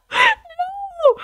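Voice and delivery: high shocked cry of no; shocked shriek. Real, not acted. Shocked at lookalike character.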